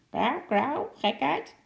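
high, squawking parrot voice; schreeuwende papegaai. A narrator's reading. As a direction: squawk it like a cheeky parrot, pitch shooting up at the end. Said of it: "begon te schreeuwen". (hoge, krijsende papegaaienstem)

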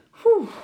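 high, loud puffing sigh; sighed "phew". A narrator's reading. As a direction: heave a big "phew" of relief. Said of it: "zuchtte oom Nijltje".

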